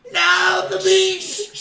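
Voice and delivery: high, loud goblin cry; goblin shriek. Acted. Goblin wails at the dropped meat, high and loud. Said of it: "and he goes".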